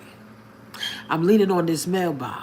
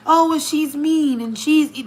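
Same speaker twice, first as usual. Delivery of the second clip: higher, drawn-out mock complaint; mocking critics; 9 semitones higher, slower than usual. Imitating people who think she's mean, mocking. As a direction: mimic the people judging you.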